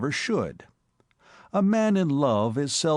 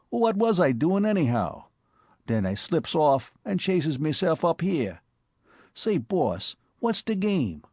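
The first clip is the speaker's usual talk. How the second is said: higher, brash New York street voice; Bowery boy Spike; 6 semitones higher than usual. Acted. Spike breathlessly telling his boss about the cop, in a higher brash Bowery voice. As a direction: play the excitable Bowery tough.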